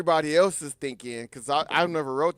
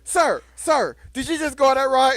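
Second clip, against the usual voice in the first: calling out; 8 semitones higher, 6 dB louder than usual. Retold or imitated. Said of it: "he's like".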